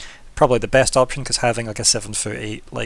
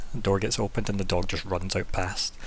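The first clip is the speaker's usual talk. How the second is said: normal talking voice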